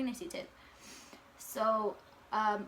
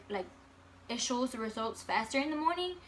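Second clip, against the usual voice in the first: ordinary talk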